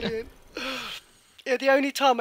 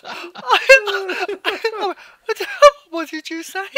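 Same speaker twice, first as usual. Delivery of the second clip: much higher, stammering in disbelief; shocked laughing; 10 semitones higher, 9 dB louder, much slower than usual. Real, not acted. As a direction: gasp out the question through laughter. Mum shocked by son swearing.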